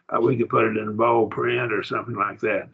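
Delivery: ordinary delivery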